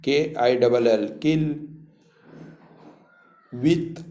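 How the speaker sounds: ordinary delivery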